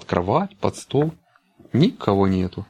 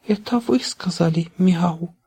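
timid whisper; 9 semitones higher than usual. A narrator's reading. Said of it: "прошептал щенок".

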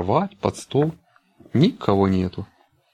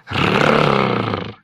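low rolling puppy growl; growling dog voice; 7 semitones lower than usual. A narrator's reading. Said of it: "зарычал". (собачье рычание)

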